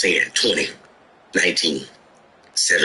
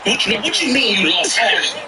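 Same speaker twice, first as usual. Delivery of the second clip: higher, disbelieving wife; wife demanding answers in the car, pitched up; imitating wife; 11 semitones higher than usual. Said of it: "She was like".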